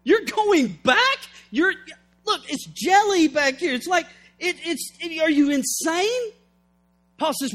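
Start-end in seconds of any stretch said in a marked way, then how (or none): 0.0-6.3 high-pitched, disbelieving mock protest; incredulous body. Paul's body objecting to going back, in mock outrage. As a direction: sputter in hammed-up disbelief, voice jumping up. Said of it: "don't you think his body said".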